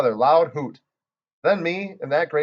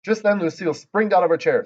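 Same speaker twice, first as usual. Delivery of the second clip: plain reading voice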